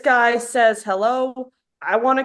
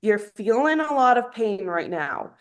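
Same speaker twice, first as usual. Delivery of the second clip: ordinary talk, nothing marked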